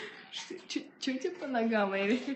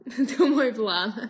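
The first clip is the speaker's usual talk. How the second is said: laughing; 6 dB louder, slower than usual. Real, not acted. Laughs after the teasing.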